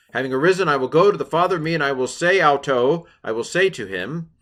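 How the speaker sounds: dramatic reading. Acted. Reading the son's speech dramatically.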